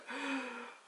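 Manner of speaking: high, sharp intake of breath; acted pain gasp. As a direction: gasp as a contraction hits, in imitation. Acting out next contraction arriving.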